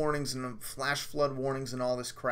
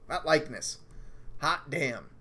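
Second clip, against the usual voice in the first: ordinary talk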